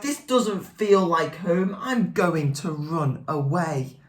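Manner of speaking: ordinary delivery, nothing marked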